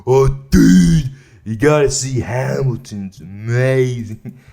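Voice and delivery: loud, drawn-out hammed-up gushing; mock raving fan. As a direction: imitate an overexcited friend, stretching the words. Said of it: "he's raving about it".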